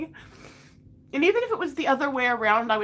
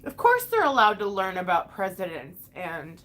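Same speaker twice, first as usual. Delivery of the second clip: usual speaking voice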